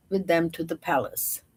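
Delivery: plain reading voice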